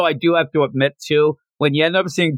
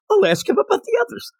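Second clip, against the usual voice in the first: high quick character voice; faster than usual. Retold or imitated. Quoting characters in recap.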